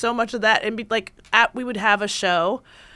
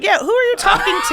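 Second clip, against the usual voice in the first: high, quick and loud, through laughter; mock indignation; 10 semitones higher, 5 dB louder, faster than usual. Retelling a reaction to who are you talking to, mock indignant. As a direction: retort in amused disbelief.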